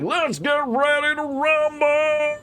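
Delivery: booming drawn-out ring announcer call; wrestling promo shout. Retold or imitated. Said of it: "do an old school wrestling promo".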